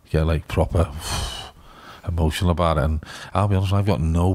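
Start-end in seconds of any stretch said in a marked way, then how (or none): 0.9-1.5 long, voiceless puff of breath; heavy sigh of emotion; overwhelmed exhale. Retold or imitated. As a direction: blow the air out, overcome. Exhales to show being overcome.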